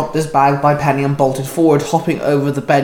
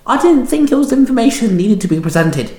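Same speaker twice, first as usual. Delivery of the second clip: higher voice for Penny, matter-of-fact; Penny's voice; 8 semitones higher than usual. A narrator's reading. Penny coolly answering Shinzo's accusation, higher voice. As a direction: lift the voice into Penny's. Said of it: "Penny replied".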